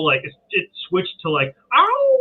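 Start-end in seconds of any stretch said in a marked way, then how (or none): 1.7-2.2 very high, long howl; coyote howl. Retold or imitated. Coyotes switching from cackle, imitated howl. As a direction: imitate a coyote howling far off. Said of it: "eventually it started to go".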